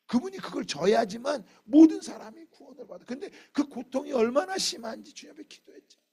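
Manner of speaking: high, loud sermon delivery surging on key words; full-force preaching. Real, not acted. Preacher proclaiming God's unknowable works, full-force preaching. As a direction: proclaim it at the top of the voice.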